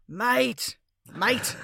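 mock-aggressive Australian shout; harsh Australian. Shown as a demonstration. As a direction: belt out an aggressive Aussie "mate". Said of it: "make a script feel harsh".

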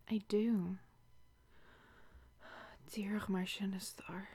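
soft, unvoiced seductive whisper; intimate whisper. Whispering to her lover as he touches her, seductive. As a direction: breathe it into his ear.

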